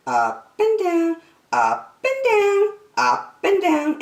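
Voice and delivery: seesaw the pitch like a jiggled line; singsong up and down. A narrator's reading. Narrator acts jiggling line.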